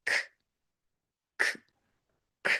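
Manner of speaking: k as a voiceless puff of air; slight air. Shown as a demonstration. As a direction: breathe out k with no vowel. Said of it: "slight air coming out of your mouth".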